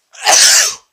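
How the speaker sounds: sharp breathy sneeze. Real, not acted. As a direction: sneeze out hard. Snuff making him sneeze.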